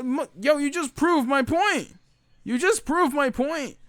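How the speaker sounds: high, drawn-out exclamation of vindication; incredulous, high-pitched gotcha; exasperated outburst. Real, not acted. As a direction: cry it out slowly, pitch way up. Exasperated outburst at retold customer's plea.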